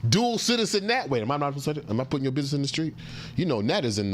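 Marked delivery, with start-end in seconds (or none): 0.1-1.1 excited greeting. Real, not acted. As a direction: greet them with big excitement.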